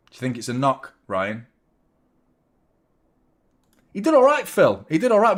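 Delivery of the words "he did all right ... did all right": higher, louder, pleased about the effort; excited cheer. Real, not acted. Streamer cheering a decent attempt, excited. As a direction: cheer him on warmly.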